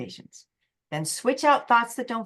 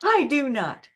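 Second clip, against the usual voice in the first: defensive retort; 6 semitones higher, 5 dB louder, slower than usual. Retold or imitated. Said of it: "come back with another fact".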